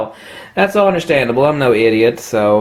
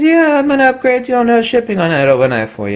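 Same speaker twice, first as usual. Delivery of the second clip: put-on higher, louder friendly old clerk; old salesman impression; 10 semitones higher, 3 dB louder than usual. Old clerk back on the phone with the lens, lightly voiced. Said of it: "He says".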